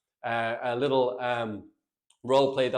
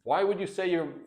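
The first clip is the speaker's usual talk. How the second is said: ordinary talk, nothing marked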